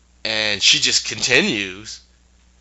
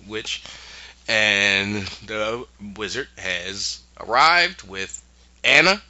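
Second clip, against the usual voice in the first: ordinary delivery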